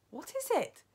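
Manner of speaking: high-pitched startled question; crying out. A narrator's reading. Witches questioning the one who stopped them, crying out. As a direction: cry out the question, pitched well up. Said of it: "the others cried out".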